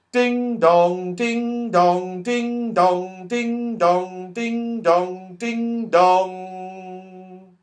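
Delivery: sing-song chime ding dong; clock chiming. Pretend.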